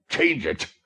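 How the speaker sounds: high, loud exasperated cry; exasperated exclamation. A narrator's reading. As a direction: explode at her stubbornness.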